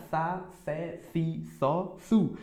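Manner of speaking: sa, sé, si, só, su with the tongue between the teeth; lisped s drill. Shown as a demonstration. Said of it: "assim você pronuncia".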